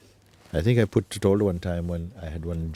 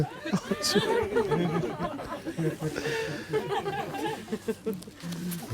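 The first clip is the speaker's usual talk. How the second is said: long high rolling laugh; extended high laughter fit; long real laughter; 6 dB quieter than usual. Real, not acted. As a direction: let a long delighted laugh roll out. Long laugh after the story.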